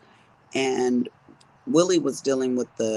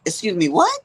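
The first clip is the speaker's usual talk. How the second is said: high, louder incredulous reaction; mock disbelief; 7 semitones higher, 5 dB louder than usual. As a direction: react to the bickering couple with raised pitch. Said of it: "I'm looking at these two fools like".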